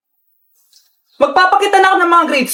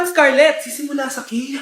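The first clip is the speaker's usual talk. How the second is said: usual speaking voice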